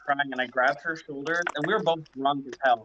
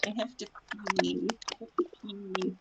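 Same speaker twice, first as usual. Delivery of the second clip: desperate whine; 6 semitones higher, 6 dB quieter than usual. Retold or imitated. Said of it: "I was like".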